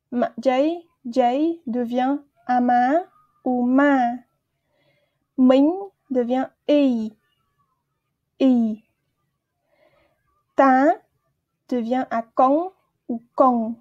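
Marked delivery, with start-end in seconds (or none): none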